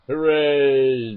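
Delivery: loud drawn-out hooray; mock cheer. Announcing voice acting to viewers, mock cheer.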